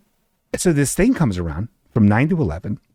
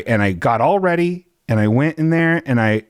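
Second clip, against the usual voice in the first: ordinary talk, nothing marked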